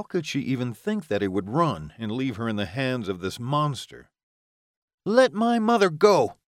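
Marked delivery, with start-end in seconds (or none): 5.0-6.4 put-on high, loud young hero's demand; a boy shouting a demand at the vampire; boy's demand. A narrator's reading. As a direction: order him to let her go, voice high and loud. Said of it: "he demanded from the vampire".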